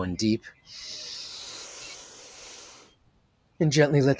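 slow, calm meditation voice; soothing guide talking a group through breathing; guided meditation. Acted. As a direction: ease them into stillness, unhurried.

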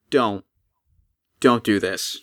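calm them down low; gentle golem plea. Acted. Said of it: "just kind of like".

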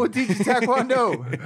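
high, laughing infomercial voice; hammed-up announcer pitch; mock TV commercial. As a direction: sell the gym like a cheesy local ad. Mock ad for the gym.

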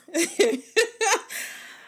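loud high burst of laughter; real giggle. Real, not acted. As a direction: crack up at the story to come. Laughing before the story.

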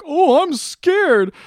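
high, slow mock-frightened whimper; mock scared. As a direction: ham it up, high and drawn out. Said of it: "And Sinclair's like".

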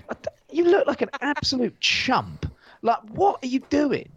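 high, scornful jeer; mocking disbelief. Viewer jeering at Wolfgang in headphones, high and scornful. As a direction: laugh at him like he's a chump. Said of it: "And you're like".

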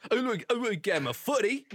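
put-on high-pitched parody English accent; mock English accent. Echoing co-host's football bit, high mock English. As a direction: squeak out a silly English lad.

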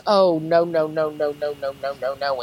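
a hint of the medium shaking her head, trailing off; medium refusing. Imitating the medium zeroing in on her husband, fading. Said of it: "she goes".

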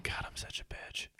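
breathy whisper, nearly no voice; whispered self-put-down. Retold or imitated. To himself while looking at Haley, whispered. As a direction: hush it like a confession to yourself.

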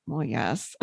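low, soft reluctant admission. Retold or imitated. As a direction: mumble a grudging yes. Client reluctantly admits in story.

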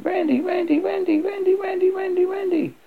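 high frantic repeated cries; screaming a name. A narrator's reading. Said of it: "Screams Randy".

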